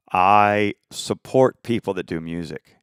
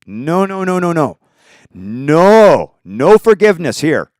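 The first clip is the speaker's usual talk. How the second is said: rising, higher and louder as it builds to a near shout; angry refusal; 6 semitones higher, 5 dB louder than usual. Real, not acted. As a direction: hammer each no harder and louder. Furious rant against the Japanese.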